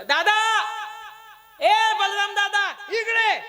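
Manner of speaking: high, loud drawn-out holler; calling out. Acted. Krishna hails his brother across the battlefield, calling out. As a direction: shout to summon him from afar. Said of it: "आवाज दिला".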